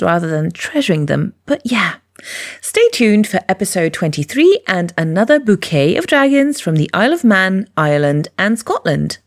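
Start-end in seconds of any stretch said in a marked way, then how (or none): none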